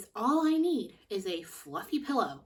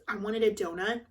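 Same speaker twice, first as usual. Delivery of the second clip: normal talking voice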